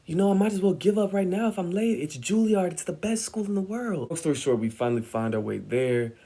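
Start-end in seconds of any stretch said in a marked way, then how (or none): 0.1-4.1 high, fast, frantic pleading; anxious kid hurrying mom through New York; panicked rushing. Retold or imitated. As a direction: rush your mom, you're about to be late. Panicked teen rushing mom in NYC.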